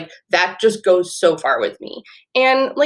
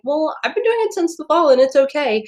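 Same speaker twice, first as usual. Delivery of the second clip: much higher, sweet, well-meaning voice; brightly reassuring but still missing the point; kindly meant version; 8 semitones higher than usual. Shown as a demonstration. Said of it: "your heart's in the right place".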